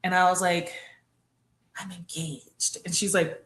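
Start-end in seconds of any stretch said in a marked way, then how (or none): 1.8-2.8 very soft, low-key delivery; understated announcement. Retold or imitated. Daughter telling mom on the phone, hushed and understated. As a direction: break the news quietly. Retold news broken to mom.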